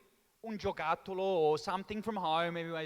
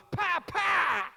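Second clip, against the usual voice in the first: high, loud slap sounds; smack noises; 11 semitones higher, 6 dB louder than usual. Retold or imitated. Dad's smacks imitated with sharp sounds. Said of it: "Straight away in front of my face".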